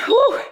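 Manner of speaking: sharp high woo of effort; high whoop. Real, not acted. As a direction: let out a high excited woo. Exertion whoop during burn.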